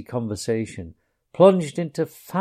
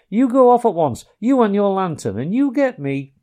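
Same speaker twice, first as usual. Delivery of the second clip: higher, louder orders; Rat orders; 9 semitones higher, 6 dB louder than usual. A narrator's reading. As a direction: send the mouse off briskly.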